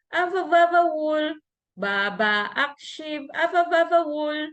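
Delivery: put-on high childlike Baba akship, have you ever wool; toddler's mispronunciation. Mom imitating her son's garbled nursery rhyme, high. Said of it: "he could not say it clearly".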